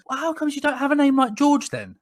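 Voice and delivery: high, bewildered caller voice; the caller questioning his name, high and baffled; imitating the caller. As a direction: pitch it way up, genuinely puzzled. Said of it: "and he goes".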